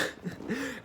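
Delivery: high, soft burst of laughter. Real, not acted. Storyteller cracks up over the manager's nerve, laughing. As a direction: laugh in disbelief at your own story.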